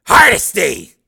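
loud, high barking chief; gruff police chief. Acted. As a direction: bark like a surly boss.

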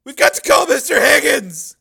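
high, loud, alarmed plea; panicked urging. Acted. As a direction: urge action in a panic. Panicked man after kidnap call.

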